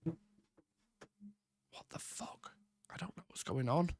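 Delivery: hushed, breathless whisper of disbelief; exasperated whisper at the broken camera; frustrated whisper. Real, not acted. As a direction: whisper it under your breath in dismay. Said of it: "whisper after tech failure".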